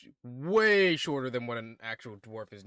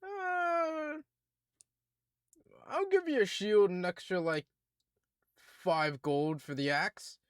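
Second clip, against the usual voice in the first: higher British voice at the start; British dwarf; 3 semitones higher than usual. Acted. Dwarf blacksmith offering a trade, mild British. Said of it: "he kinda looks at it and goes".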